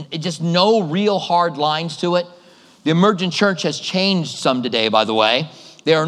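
ordinary talk, nothing marked

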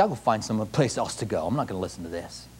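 low, soft grumble of someone leaving; quietly voiced disgruntled churchgoer; muttered refusal. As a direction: mutter it under your breath. Voicing departing churchgoers' quiet refusal.

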